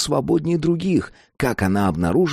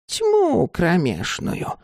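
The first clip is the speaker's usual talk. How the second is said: high, very slow crone voice; old woman's retort; 9 semitones higher, much slower than usual. A narrator's reading. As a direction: draw it out, pitched up, as the old woman. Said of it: "ответила миссис Холланд".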